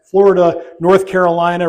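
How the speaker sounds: loud election-night roll call; emphatic retelling. Retold or imitated. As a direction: call out the states as they fall. Listing states falling on election night.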